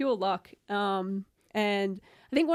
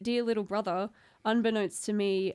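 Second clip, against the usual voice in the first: usual speaking voice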